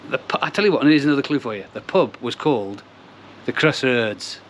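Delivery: ordinary talk, nothing marked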